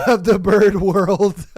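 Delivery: laughing through the punchline; words squeezed out while cracking up. Real, not acted. Reacting to a Reddit post, laughing through the punchline. Laughing about gangbanger geese.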